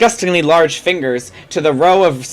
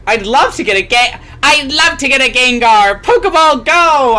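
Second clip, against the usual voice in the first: louder, higher eager cry; excited shout; 5 semitones higher, 4 dB louder than usual. Acted. Trainer Ivana hurling a Pokeball at a ghost, shouting. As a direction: throw the ball with a big eager yell. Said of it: "Ivana shouted".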